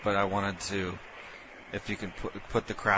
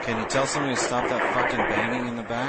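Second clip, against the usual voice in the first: louder and faster, barking a complaint at the noise; mock yell; 4 semitones higher, 7 dB louder, faster than usual. Prank caller complaining about the kitchen noise, mock yell. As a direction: shout over the kitchen noise.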